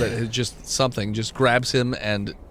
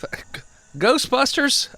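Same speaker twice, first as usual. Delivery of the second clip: surprised; 11 semitones higher, slower than usual. Acted. Sheriff surprised at ghostbusters.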